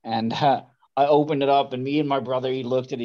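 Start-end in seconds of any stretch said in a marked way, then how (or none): none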